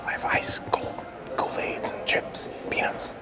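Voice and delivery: hushed sales pitch; whispering. Real, not acted. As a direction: whisper it so no one hears. Sneaky whispered snack offer.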